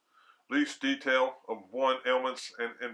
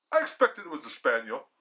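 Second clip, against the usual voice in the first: ordinary delivery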